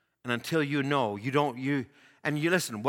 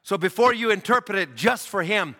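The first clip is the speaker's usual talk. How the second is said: ordinary talk, nothing marked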